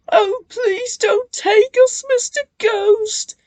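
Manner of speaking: high, loud tearful plea; pleading. A narrator's reading. Bill hiding from the ghost, pleading. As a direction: beg for mercy. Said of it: "pleaded Bill".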